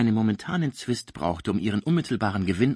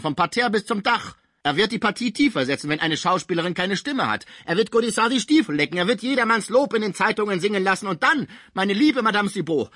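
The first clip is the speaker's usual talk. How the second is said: much higher, loud and indignant; furious old musician; 10 semitones higher, 3 dB louder than usual. A narrator's reading. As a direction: rage at the rival from the sickbed. Pons raging at Cibot. (Pons empört, hoch und laut)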